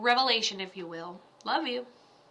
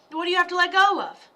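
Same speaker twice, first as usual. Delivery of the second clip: pressing the question again, louder and faster; louder insistent repeat; 6 semitones higher, 6 dB louder, faster than usual. Real, not acted. Psychic urging the viewer to let go, louder and faster. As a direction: push the question home.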